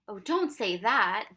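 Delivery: loud, drawn-out plea; desperate cry. A narrator's reading. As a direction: protest with slow, loud desperation. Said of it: "Ben cried desperately".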